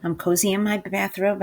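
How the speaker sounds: ordinary delivery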